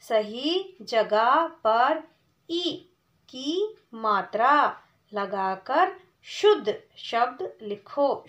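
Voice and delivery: slow, deliberate read-aloud of the task; teacher reading the question slowly. Shown as a demonstration. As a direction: read each phrase slowly for the class. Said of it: "सबसे पहले हम प्रश्न को पढ़ेंगे".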